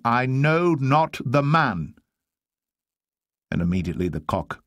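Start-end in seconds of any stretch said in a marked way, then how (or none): 0.0-1.9 high, slow, emphatic denial; cursing denial. Acted. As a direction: swear it word by word, voice raised. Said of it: "to curse and to swear".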